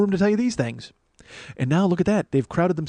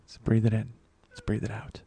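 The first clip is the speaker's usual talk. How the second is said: soft mock meditation breathing; mock calm; 6 semitones lower, 8 dB quieter than usual. Host calming himself after laughing, soft. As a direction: ease himself down gently. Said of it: "calming himself down".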